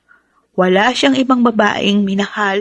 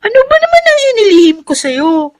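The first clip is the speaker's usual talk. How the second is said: higher and louder, mock offended; playful protest; 5 dB louder than usual. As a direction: laughingly protest to your mother. Daughter protests teasing question. (pabirong pagtutol, mas mataas at malakas)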